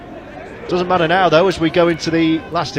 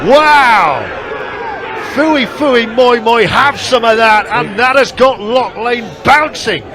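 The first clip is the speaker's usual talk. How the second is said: excited commentary; 6 semitones higher, 4 dB louder, slower than usual. Real, not acted. Calling the big hit.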